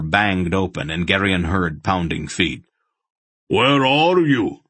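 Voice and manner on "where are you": slow, loud and high booming call; bellowing. A narrator's reading. Barak bellows up the stairs searching for his friends. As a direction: bellow it up through the house. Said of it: "Barak's voice bellowed".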